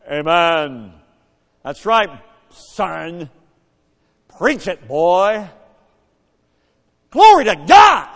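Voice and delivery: loud, high, ringing amens; booming shouts of praise. Retold or imitated. Dad shouting praise across the church. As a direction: boom it out like an old preacher praising. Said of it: "His voice would echo around the room".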